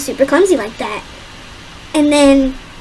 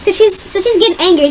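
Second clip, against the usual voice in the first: ordinary talk, nothing marked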